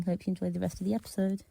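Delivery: ordinary talk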